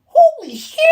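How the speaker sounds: amazed inner outburst; shocked exclamation. Retold or imitated. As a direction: yelp it out in amazement. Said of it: "that's what I'm like in my head".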